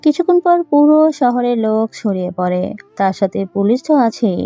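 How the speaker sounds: ordinary delivery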